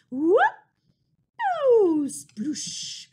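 loud whoop then splashy sploosh; silly splash sound. Shown as a demonstration. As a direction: shout it like jumping into paint. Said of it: "silly sound ... for all of the times the mice jump".

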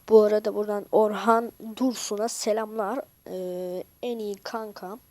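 loud, excited greeting; excited shout-out. Real, not acted. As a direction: call out the greeting loudly. Shout-out to best friend. (coşkulu, yüksek sesle selam)

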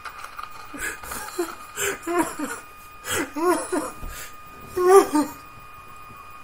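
long, high fit of giggles; helpless laughter. Real, not acted. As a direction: crack up in high peals. Laughs after asking the wrong question.

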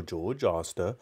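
usual speaking voice

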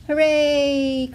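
high, loud cheer; excited quack. A narrator's reading. Duckling cheering on hedgehog, excited quack. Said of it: "quacked Dilly excitedly".

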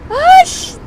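high startled shriek; startled yelp. Real, not acted. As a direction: yelp in sudden fright. Zombie jump scare.

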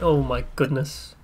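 ordinary delivery

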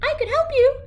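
a tiny shrew proposing teamwork. Acted. Shrew offers New to work together, tiny high voice.

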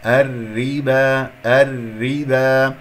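الربا slow, with thin ر and drawn-out ending; light ra. Shown as a demonstration. Teacher repeating the correct reading, light ra. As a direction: recite الربا slowly with a light ر. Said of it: "فهي مرققة".

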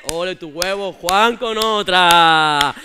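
low, drawn-out bro cheer; slow proud applause to a mate; cheering macho lads. Retold or imitated. (colegas felicitando a Juan con voz grave)

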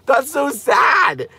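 drawn-out high wail, slowed right down; replaying her own teary reaction, mock-wailing; retold wail. Telling viewers how she reacted to the whale, in a slow high wail. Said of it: "And I was like".

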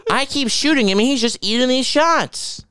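high, wide-eyed mock bewilderment; lightly voiced baffled cop; a hint of the puzzled deputy; incredulous mocking.

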